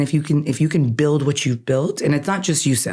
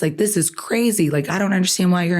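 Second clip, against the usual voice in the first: retold mad outburst; 6 semitones higher than usual. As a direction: replay your own indignant complaint, higher pitched. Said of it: "and I'm mad".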